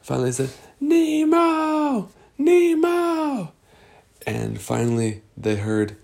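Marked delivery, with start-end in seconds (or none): 0.8-3.5 very high drawn-out call, held back in volume; long high call, not at full volume. Acted. Searchers still hunting Nemo, high restrained long call. Calling again for lost Nemo.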